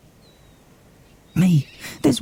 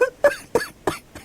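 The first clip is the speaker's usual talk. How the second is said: broken sob. Acted. Sob within crying.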